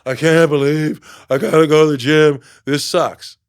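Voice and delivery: loud whiny gripe about the gym; loud sulky whine; whiny complaining. Retold or imitated. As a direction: moan it like you dread it. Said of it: "you go".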